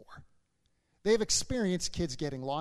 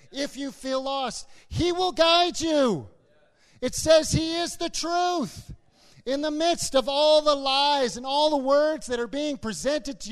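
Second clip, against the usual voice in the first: impassioned, raised pulpit delivery; full-force preaching; 7 semitones higher, 7 dB louder than usual. Real, not acted. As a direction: exhort the congregation with fervent conviction.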